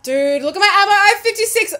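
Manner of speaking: loud, high laughing alarm; panicked shout. Real, not acted. Gamer shouting to viewers about ammo, panicked. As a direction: yell it half laughing in panic.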